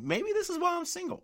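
sudden high mock-innocent aside; self-mocking aside. Real, not acted. Single guy admitting his own fault, high and sheepish.

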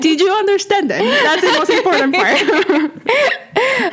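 laughing while talking. Real, not acted. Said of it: "laughing it off".

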